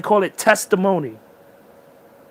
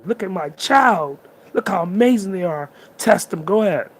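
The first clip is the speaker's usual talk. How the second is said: universe bragging; 4 semitones higher, 4 dB louder than usual. Retold or imitated. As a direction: show the child off to everyone. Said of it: "The universe is bragging about you".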